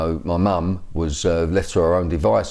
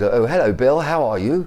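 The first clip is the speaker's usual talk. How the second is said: light mock upper-class English accent, pitched higher; Prince Charles voice; 6 semitones higher than usual. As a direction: lightly imitate a plummy royal English accent. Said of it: "he talks like Prince Charles".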